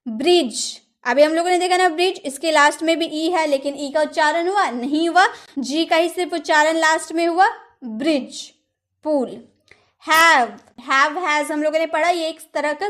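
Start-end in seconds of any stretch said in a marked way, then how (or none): none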